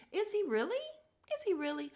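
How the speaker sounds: high, doubting questions; sceptical. Real, not acted. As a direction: pitch it way up in mock wonder. Doubts the praise of him.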